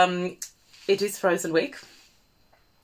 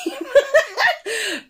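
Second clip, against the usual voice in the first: high burst of laughter; laughing; 14 semitones higher than usual. Real, not acted. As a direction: crack up at the joke. Laughing at famous flub.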